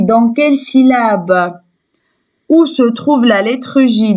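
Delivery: ordinary delivery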